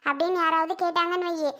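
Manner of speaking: normal talking voice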